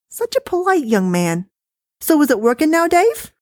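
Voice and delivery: dismissive, high and chatty; wife voice. Acted. Wife complaining to husband about the recording, high-pitched.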